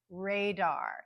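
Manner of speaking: radar with a full, clear DAR after strong RAY; don't reduce the end of radar; careful radar, both vowels full; secondary stress. Shown as a demonstration. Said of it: "second syllable has a secondary stress".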